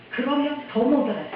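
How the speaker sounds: usual speaking voice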